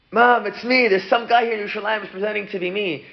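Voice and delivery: high, hurried plea; urgent appeal. Acted. Son phones his mother about an impostor, urgent appeal. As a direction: pitch it up and rush to explain.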